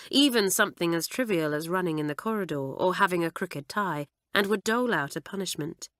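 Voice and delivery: ordinary delivery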